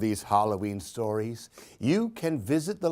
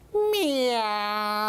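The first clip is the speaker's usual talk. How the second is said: high, drawn-out kitten meow; playful cat meow; kitty meow; 9 semitones higher than usual. Acted. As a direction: mew like a little cat. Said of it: "said Lily".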